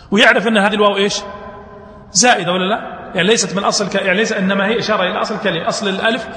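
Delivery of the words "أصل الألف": ordinary talk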